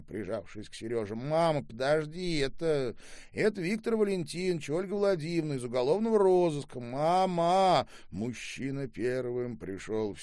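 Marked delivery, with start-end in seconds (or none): 1.1-7.5 high, loud, hurried introduction; urgent explaining. A narrator's reading. Son introduces police visitors to overwhelmed mother, urgently. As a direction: rush it out over her cries.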